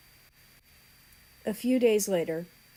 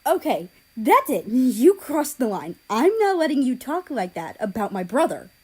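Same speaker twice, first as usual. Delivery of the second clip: loud, higher, deliberate rage; furious exclaim; 6 semitones higher, 9 dB louder than usual. A narrator's reading. Japan warns South Korea, loud and furious. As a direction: draw the line hard. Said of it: "Japan exclaimed again".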